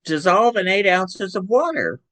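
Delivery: oh, just noticed the instructions; surprised old man. Retold or imitated. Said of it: "my grandfather looks at it and goes".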